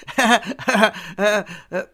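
high, shaky nervous laugh; hysterical laugh. Acted. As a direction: laugh half mad with fear. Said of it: "hisztérikusan felnevetett".

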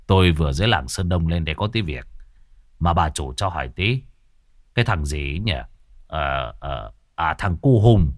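low, rough-edged middle-aged man; Cậu Sáu's voice. Acted. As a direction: drop low as the wandering sorcerer. Cậu Sáu speaking.

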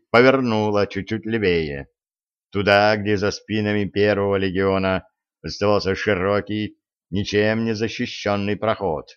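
ordinary delivery, nothing marked

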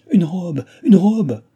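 high, loud, rising excitement; delighted cry. A narrator's reading. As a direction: cry it out with joy. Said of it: "cria Chon en battant des mains".

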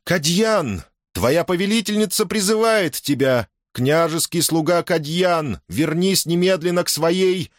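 high, commanding call; imperious, furious summons. A narrator's reading. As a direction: summon a servant with absolute authority. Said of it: "властный, яростный, непререкаемый".

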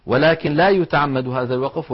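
usual speaking voice

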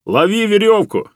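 high, carrying shout; shouted instruction. A narrator's reading. Master throws a rope to the hunter, shouted instruction. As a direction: call it down into the crevice. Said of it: "крикнул ему Алексей".

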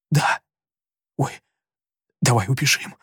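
scared whisper. A narrator's reading. Frightened boy wants to flee.

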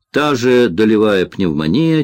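ordinary delivery, nothing marked

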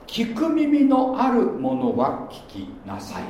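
drawn-out, solemn proclamation; scripture read slowly and weightily. Acted. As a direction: intone each word slowly like a pronouncement.

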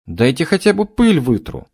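put-on high whiny girl's pleading; whining girl. A narrator's reading. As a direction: whine like a scolded young maid. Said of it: "заныла горничная".

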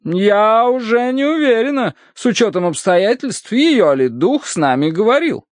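high-pitched matron having doubts; doubting matron. A narrator's reading. Mrs. Mering doubts the spirit's message, doubting matron. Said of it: "усомнилась миссис Меринг".

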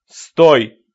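sharp loud stop; stern command. Acted. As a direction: bark it with authority. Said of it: "Окрик прозвучал грозно и резко".

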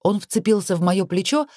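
plain reading voice

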